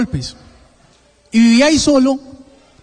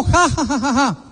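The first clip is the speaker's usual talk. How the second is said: imitated jeering "ha ha ha"; mock crowd laughter; 2 semitones higher than usual. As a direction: fake laugh, trailing downward. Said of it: "todo el mundo se rió". (risa burlona imitada)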